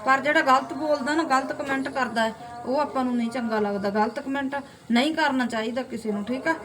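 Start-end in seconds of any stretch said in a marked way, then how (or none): none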